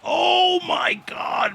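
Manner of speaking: sudden high yell of disbelief; real shock, a high burst; shocked shout. Real, not acted. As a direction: blurt it out, stunned and loud. Shocked knockout reaction.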